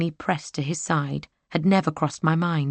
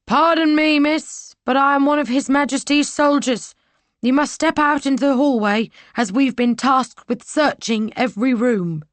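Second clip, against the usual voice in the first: loud, high soldier at the door; booming soldier; 9 semitones higher, 5 dB louder than usual. Acted. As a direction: call through the door with authority. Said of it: "The soldier's voice boomed through".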